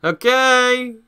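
high, loud celebratory cheer. Real, not acted. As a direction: cheer the small win. Cheering after success.

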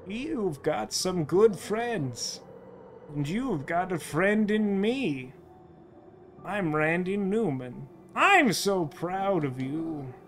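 slow, drawn-out mother's voice; proud, tender mother. Acted. Mother telling her child how proud she is, slow and tender. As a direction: take it slowly and lovingly.

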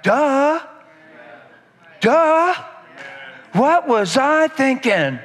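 smack your forehead and say it; a playful "duh, what was I thinking"; mock duh. Mock self-slap repentance.

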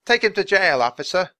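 high, loud demand; shouting. Acted. Boy urging an arrest, shouting high. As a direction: demand it loudly of the policeman. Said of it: "the boy shouted".

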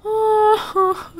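high, loud, breaking cry of oh. Real, not acted. Streamer mourning her dog, high crying oh. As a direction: sob it out.

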